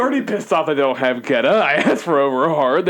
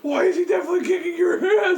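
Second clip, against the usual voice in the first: mock outraged shout. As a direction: yell it in fake indignation. Mock outraged yell at friend.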